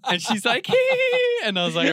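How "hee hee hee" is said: very high, loud mock giggle; high-pitched fake giggle; imitated girly giggle.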